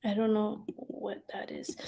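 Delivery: puzzled whisper under her breath; soft unvoiced aside. Real, not acted. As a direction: drop to a whisper, half to herself.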